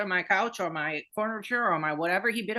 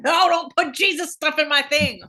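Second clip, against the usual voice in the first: loud laughing no, higher; laughing protest; 7 semitones higher, 10 dB louder than usual. Real, not acted. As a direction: shout it through laughter.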